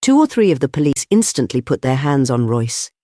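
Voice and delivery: usual speaking voice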